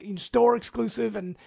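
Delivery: ordinary delivery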